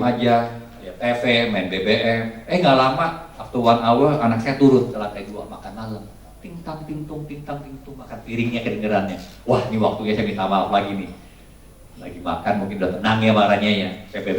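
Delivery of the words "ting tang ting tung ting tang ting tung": imitated clinking. Imitates clinking cutlery at dinner.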